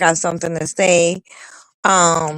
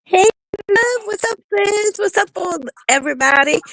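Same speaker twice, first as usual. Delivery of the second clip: excited greeting; 13 semitones higher than usual. Real, not acted. High greeting.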